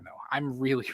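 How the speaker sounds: normal talking voice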